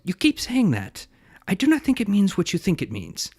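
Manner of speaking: lightly voiced Inigo Montoya in a Spanish accent; weak Spanish accent. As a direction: try Inigo's Spanish accent and barely land it. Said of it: "my Spanish accent was not good".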